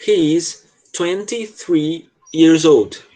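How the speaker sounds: very slow, careful teacher read; very slow model line. Shown as a demonstration. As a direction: spell it out slowly for the student. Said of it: "começa assim". (leitura bem lenta e pausada)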